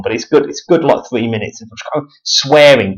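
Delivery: normal talking voice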